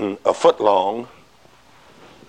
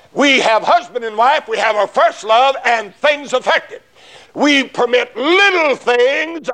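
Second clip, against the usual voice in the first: preacher pressing the point hard, voice raised; fervent preaching; 11 semitones higher than usual. Acted. Preacher warning the congregation, raised fervent preaching.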